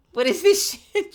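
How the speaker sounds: high, loud laughing outburst; surprised laugh. Real, not acted. Pet dancing around, surprised.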